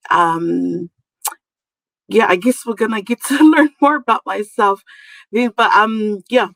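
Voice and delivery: ordinary talk, nothing marked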